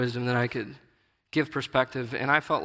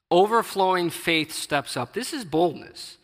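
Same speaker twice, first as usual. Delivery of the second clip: slower, higher, louder preaching; preacher swelling on the crumb of faith; building preaching; 5 semitones higher, 4 dB louder than usual. Acted. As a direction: lean into it, rising fervour. Preaching climax on boldness.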